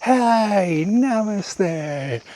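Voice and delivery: imitating a high bright greeting; mock cheery greeting. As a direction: parody of own chirpy namaste. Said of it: "going around going like".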